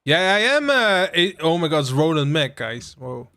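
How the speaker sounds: normal talking voice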